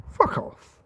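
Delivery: another whispered fuck off, voiceless and scornful. Real, not acted. Fed-up vlogger brushing off hypocrites, whispered dismissal. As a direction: breathe it out with contempt. Dismissing racist double standards.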